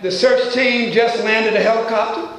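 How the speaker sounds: normal talking voice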